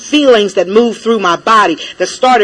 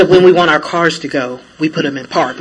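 lower, quieter cop imitation; 6 semitones lower, 4 dB quieter than usual. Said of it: "he goes".

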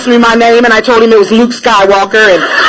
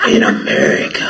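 much lower, breathy cop impression; the cop again, voiced even deeper; 5 dB quieter than usual. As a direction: sink right down into his voice. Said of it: "That's how his voice sounded".

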